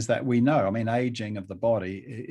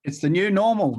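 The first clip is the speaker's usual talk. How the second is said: emphatic agreement; 8 semitones higher, 7 dB louder than usual. Real, not acted. Echoing Marco's phrase with emphasis.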